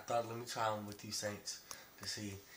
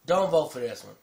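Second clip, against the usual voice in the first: much louder, emphatic advice; loud warning; 3 semitones higher, 15 dB louder than usual. Real, not acted. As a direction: warn them hard. Warning viewers loudly.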